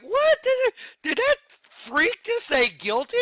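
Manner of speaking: squeaky high-pitched disbelief; shocked outburst. Retold or imitated. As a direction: shriek it up high like a chipmunk. Shocked at the guilty verdict.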